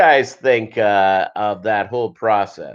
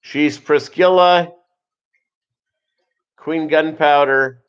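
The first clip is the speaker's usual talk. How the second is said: regal announcement; 3 semitones higher than usual. Shown as a demonstration. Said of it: "A little queen take there".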